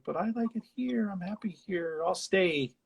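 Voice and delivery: put-on high, pleading little-girl voice; imitating a girl insisting she's happy here. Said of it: "And she's like".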